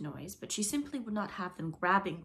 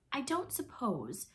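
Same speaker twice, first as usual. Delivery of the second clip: slow, higher, hesitant request; diffident; 6 semitones higher, slower than usual. A narrator's reading. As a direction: venture it timidly, drawing it out. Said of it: "Toodles said diffidently".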